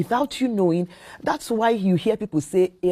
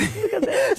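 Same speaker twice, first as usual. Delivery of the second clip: high bursting laugh; a real high laugh breaking out; burst of laughter. Real, not acted. Laughing at husband story.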